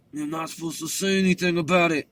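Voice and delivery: put-on a hushed older brother's rebuke; hushed warning. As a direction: shush him with a warning. Older brother hushing his sibling.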